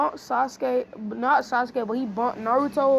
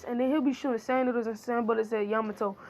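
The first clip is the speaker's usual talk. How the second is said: plain reading voice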